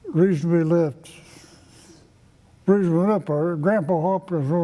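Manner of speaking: usual speaking voice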